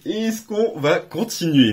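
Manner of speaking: slow, teasing repeat; slow, suspenseful repeat; drawn-out suspense. Real, not acted. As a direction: stretch it out to build suspense. Suspense before next booster.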